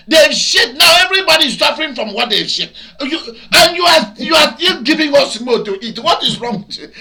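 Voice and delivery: loud, fast, high scolding; angry shout. Real, not acted. Scolding the shop man about coronavirus, loud, fast and angry. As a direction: tear into him for selling it.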